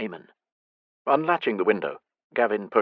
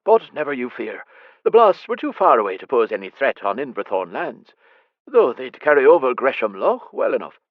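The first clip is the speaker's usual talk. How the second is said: reassuring stablemaster; 4 semitones higher, 6 dB louder than usual. Acted.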